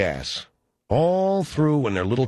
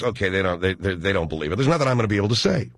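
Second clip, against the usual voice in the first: ordinary talk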